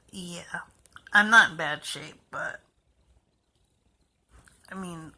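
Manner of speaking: hushed confiding whisper; quiet reassurance under the breath. Real, not acted. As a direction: whisper it like a secret. Confiding softly to viewers.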